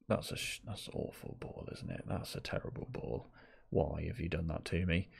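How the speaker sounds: near-whispered grumble about a bad pass; muttered disgust. Real, not acted. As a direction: breathe it under your breath, disgusted.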